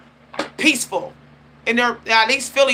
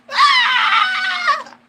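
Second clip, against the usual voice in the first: sudden high yelp of a cursing person; mock shriek of someone losing it; imitated curse scream. Mocking a cursing person with a sudden yelp. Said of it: "You curse".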